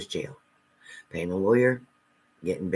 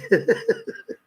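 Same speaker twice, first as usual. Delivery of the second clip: breathy laugh; 8 semitones higher than usual. Real, not acted. Laughs at her own anger.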